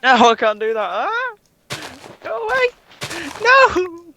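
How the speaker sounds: high frantic screams; panicked screaming. Real, not acted. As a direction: shriek in panic at the attacker. Attacked in game, panicking.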